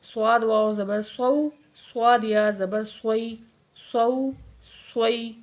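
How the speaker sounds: "سواد" with a heavy, rounded s; thick swaad. Shown as a demonstration. Teacher drills swaad for learners, "سواد" said thick. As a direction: fill the mouth on "سو، سوئی". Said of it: "سواد کو موٹا پڑھیں گے".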